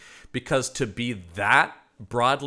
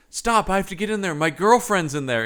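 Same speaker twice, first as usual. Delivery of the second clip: put-on desperate boyfriend; 7 semitones higher, 3 dB louder than usual. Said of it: "And he goes".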